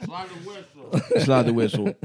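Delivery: normal talking voice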